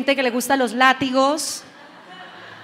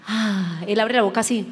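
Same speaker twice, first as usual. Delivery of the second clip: ordinary talk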